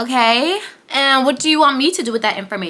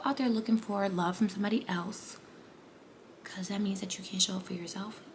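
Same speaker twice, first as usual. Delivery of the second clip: unvoiced whisper, much softer; 5 semitones lower, 16 dB quieter than usual. Real, not acted. Whispering a truth to the viewer. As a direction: lean in and breathe it.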